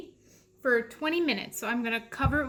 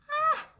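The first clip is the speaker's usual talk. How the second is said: high strained yelp; 4 dB louder than usual. Real, not acted. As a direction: yelp with the effort. Straining at jar.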